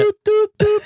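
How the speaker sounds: high, loud mimicked dial tone; imitated phone beeps. Boss left on a dead line, imitated beeping. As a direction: hoot out three phone beeps.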